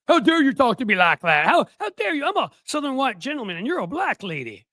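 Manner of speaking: high, loud, hammed-up outrage; mock indignant Southern gentleman. Host voicing Jennings's imagined thoughts at Abby, mock indignation. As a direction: imitate an affronted Southern gentleman, pitch way up. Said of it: "I know that's what he was thinking".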